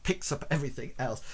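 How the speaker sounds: ordinary talk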